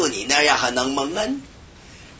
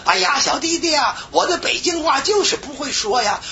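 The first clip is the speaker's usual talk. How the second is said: voicing a helpless older woman; 12 semitones higher than usual. Older sister's helpless reply.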